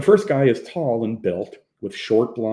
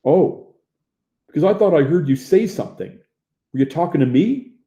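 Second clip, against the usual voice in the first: loud, raised, needling questions; belligerent challenge; 7 semitones higher, 7 dB louder than usual. A narrator's reading. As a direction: press him, spoiling for a fight. Bully keeps challenging Hopper.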